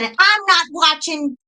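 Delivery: high, emphatic retold refusal; re-enacted stubborn defiance; determined refusal. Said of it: "And I was determined".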